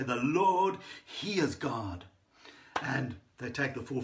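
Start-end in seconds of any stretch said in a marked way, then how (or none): 0.0-2.0 loud retold acclamation, slowing at the end; proclaiming cry. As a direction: proclaim it like an awestruck crowd. Crowd's awed cry in retold story.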